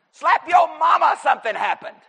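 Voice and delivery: shouted high and loud, bursting with joy; excited shout. Real, not acted. Preacher to congregation, excited shout. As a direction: holler it out like good news can't wait.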